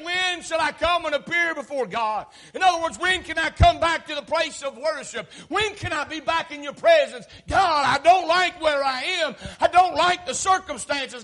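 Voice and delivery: high, loud, insistent preaching; preacher crying out as the exile; impassioned plea. Acted. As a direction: plead with God in rising urgency. Preacher acts the exiled psalmist's plea.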